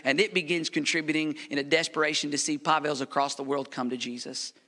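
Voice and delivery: ordinary delivery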